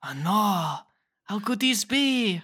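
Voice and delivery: loud mock dismay in a European accent; hammed-up foreign accent, theatrically dismayed; put-on European accent. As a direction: lament it in a silly European accent. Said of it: "that accent you were doing".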